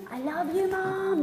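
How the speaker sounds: a hint of a sweet little boy; sweet child voice. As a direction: coo like a loving little boy. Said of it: "blowing kisses".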